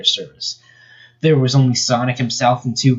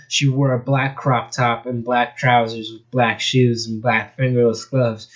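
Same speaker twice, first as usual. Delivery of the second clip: ordinary delivery, nothing marked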